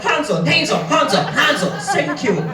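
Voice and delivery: mock Italian mobster tearing into a customer; Joe Pesci rant. Server goes mock mobster on the customer, ranting. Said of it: "my Joe Pesci just snapped".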